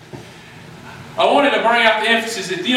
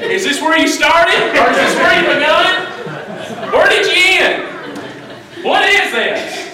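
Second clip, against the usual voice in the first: wife puzzled; 7 semitones higher than usual. Retold or imitated. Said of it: "she's over there scratching her head".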